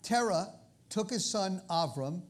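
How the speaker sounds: usual speaking voice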